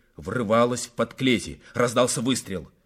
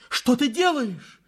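high, drawn-out frightened cry; slow high shriek of a question; alarmed cry; 12 semitones higher than usual. A narrator's reading. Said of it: "вскричала боярыня".

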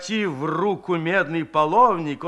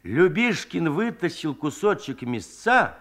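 ordinary delivery, nothing marked

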